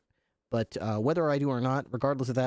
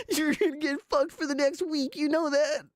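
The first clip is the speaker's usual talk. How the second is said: muy agudo y lento, entre risas; mocking troll; 13 semitones higher than usual. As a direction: imita al troll en falsete burlón. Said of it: "he's just like".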